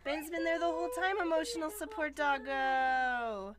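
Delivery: cooing. Real, not acted. Cooing over the dog.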